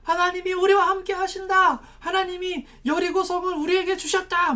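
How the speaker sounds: acted triumphant shouting; mass battle cry. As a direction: cry out like a crowd storming the walls. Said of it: "큰 소리로 막 외쳤어요".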